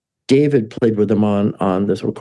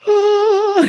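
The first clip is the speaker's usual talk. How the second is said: awed gasp. Retold or imitated. Fans react to praise.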